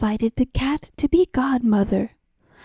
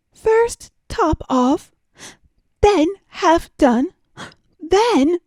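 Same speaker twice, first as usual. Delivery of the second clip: mounting accusation; 7 semitones higher, 3 dB louder than usual. Acted. Mouse confronts the cat, counting its lies angrily. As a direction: build the charge name by name.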